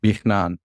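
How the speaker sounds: "Bichnan" with K and N together, K softened; no vowel. Shown as a demonstration. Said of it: "say the K and the N together with no vowel".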